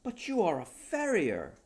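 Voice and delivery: high, loud indignant outcry; crying out. Acted. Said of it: "cried Johnson".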